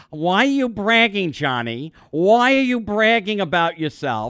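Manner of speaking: high, loud mock scolding mother. Mother scolding her son for bragging. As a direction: nag like a disapproving mother. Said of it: "my mother telling me".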